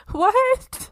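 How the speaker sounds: very high-pitched shocked question; incredulous reaction. Retold or imitated. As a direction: squeak it out in mock disbelief. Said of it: "I'm just like".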